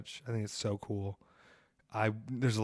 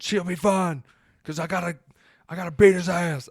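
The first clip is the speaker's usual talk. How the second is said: tough wrestler; 6 semitones higher, 5 dB louder than usual. Retold or imitated. Voicing Mance again.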